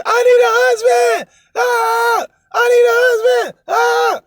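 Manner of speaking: high, loud wailing plea for a husband; imitating a woman crying out for a husband; desperate wailing woman. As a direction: cry it out like a hysterical woman. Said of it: "just crying out".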